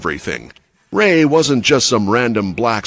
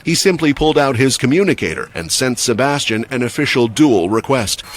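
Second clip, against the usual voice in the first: usual speaking voice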